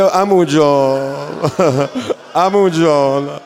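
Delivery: put-on very slow, drawn-out cry of a dying child; grieving call for uncle; wailing plea. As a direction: stretch each word like a sob. Voiced dying boy calls uncle.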